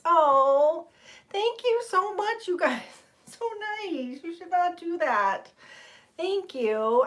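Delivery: high, touched, slowed thanks; overwhelmed by kindness; gushing thanks. Real, not acted. Streamer gushing high to the chat after gifts. Thanking viewers for kind comments.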